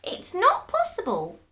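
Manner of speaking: put-on high, loud old woman denying it; high protest. A narrator's reading. Frightened wife denies she is shrinking, high. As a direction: push it up high, refusing.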